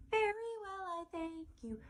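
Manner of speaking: squeaky high mommy reply; female mom voice. Shown as a demonstration. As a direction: answer like a dainty high-pitched lady. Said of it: "So it's gonna have a female voice".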